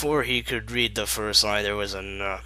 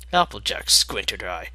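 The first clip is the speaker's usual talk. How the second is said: usual speaking voice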